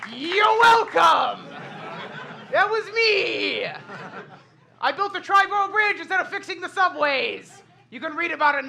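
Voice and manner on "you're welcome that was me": slow, drawn-out showy brag; proud boast. Acted. Host claims credit for the subway trouble, high drawn-out boast. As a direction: take the credit grandly, stretching each phrase.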